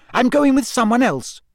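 high, forceful angry retort; Hermione, very angry. A narrator's reading. Hermione telling Ron she has a date, very angry. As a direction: fling it at him angrily. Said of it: "Hermione said very angrily".

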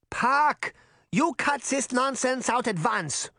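high, scolding granny demand; demanding shout. A narrator's reading. Granny orders the boy to stop, shouting. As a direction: scold him in a high, insistent voice. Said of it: "she demanded, her shouts waking".

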